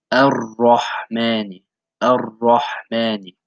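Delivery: "Ar-Rahmani" slowly, with the ra doubled and heavy. Shown as a demonstration. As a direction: lean hard on the double r. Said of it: "stress it as if there were two ra's".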